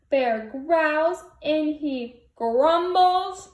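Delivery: slow heavy read of the angry bear; slow grumbly bear refrain; grumpy bear reading. A narrator's reading. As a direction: stomp through each line.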